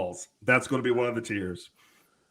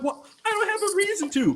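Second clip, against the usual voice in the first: much higher, innocent falsetto; high squeaky denial. Retold or imitated. Reacting to the Camden warning in a high mock-innocent squeak. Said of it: "I'm like".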